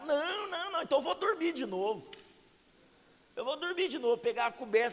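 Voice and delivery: high, whiny refusal; mock petulant protest to God; stubborn protest. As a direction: play up the sulky believer.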